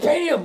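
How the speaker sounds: loud, high "bam"; imitated impact noise. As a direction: punch it out like a blow. Imitates Fett striking.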